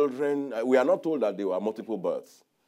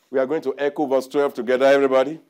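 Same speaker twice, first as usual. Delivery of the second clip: loud, quick call to the crowd; rally everyone to read along; 10 dB louder, faster than usual. Real, not acted. Preacher calls the congregation to echo, loudly.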